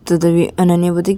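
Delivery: usual speaking voice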